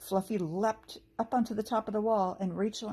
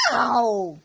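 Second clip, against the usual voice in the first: loud goblin howl of pain; 3 semitones higher, 11 dB louder than usual. A narrator's reading. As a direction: yelp as if stabbed by prickles. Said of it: "both the goblins howled".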